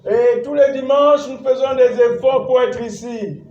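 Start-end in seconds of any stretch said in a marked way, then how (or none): none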